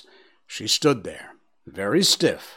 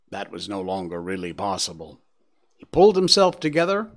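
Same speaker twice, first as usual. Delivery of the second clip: usual speaking voice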